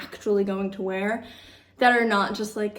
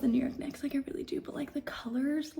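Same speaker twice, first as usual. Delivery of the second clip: whispering; 3 semitones higher, 7 dB quieter, faster than usual. Real, not acted. Mock-secret jab at team colors.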